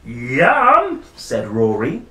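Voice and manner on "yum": high, loud yum; gleeful. Acted. Rory hearing the postman is due, gleeful cry. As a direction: cheer at the thought of food.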